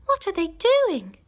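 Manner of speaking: high scared little cub voices; frightened fox cubs. A narrator's reading. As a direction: cry out like small scared children.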